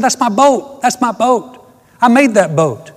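put-on high, loud urgent boy's cry; a boy pleading that the boat is his; excited boy. Boy telling the store owner the boat is his, excitedly high.

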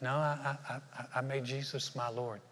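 soft, hesitant mumbled denial; sheepish mumble. Retold or imitated. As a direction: shyly fumble the answer. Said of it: "You're like".

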